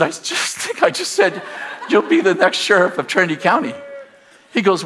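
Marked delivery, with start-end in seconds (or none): 0.0-3.7 laughing through the retold line; half-laughing disbelief. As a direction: chuckle while repeating it, high and light. Laughing retelling his own words.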